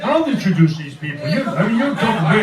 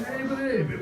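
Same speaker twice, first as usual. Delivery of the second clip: softer, higher imitation of a flirt; lightly voiced pickup line, pitched up; mock come-on; 7 semitones higher, 8 dB quieter than usual. Friend re-enacts the pass at the stewardess, mock come-on.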